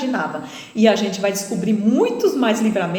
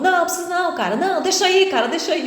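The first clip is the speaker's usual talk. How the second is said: a hint of a higher, urgent robber; other robber; 8 semitones higher, faster than usual. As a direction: urge the partner to hold off. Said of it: "e o outro". (outro bandido, aflito, mais agudo)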